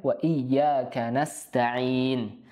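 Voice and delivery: "Wa iyyaka nasta'in" slow, held y, clean s; slow careful recitation. Shown as a demonstration. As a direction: recite slowly, hold y, sharp s. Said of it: "sin jangan tertukar dengan shin".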